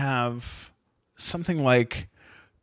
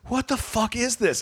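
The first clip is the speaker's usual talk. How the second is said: exasperated, pitched well up; retold angry disbelief; 10 semitones higher than usual. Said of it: "I'm so angry".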